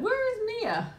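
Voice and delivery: very high squeaky voice, laughing through it; mock chat voice. Rereading a viewer's chat in a squeaky, laughing voice. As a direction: squeak it out, cracking up. Sprinkle Queen just said.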